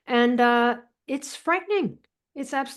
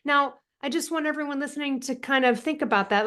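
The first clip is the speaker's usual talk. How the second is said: ordinary delivery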